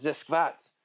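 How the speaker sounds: hammed-up German accent. Repeating his body's complaint about leg day, German accent. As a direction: put on a German accent for two words. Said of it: "in a German accent".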